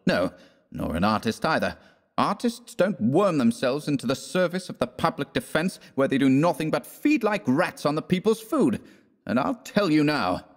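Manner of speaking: high-pitched, scornful rant; indignant tirade. Acted. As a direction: lay into him with contempt. West's angry tirade continues.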